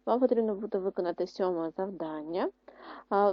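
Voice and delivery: ordinary talk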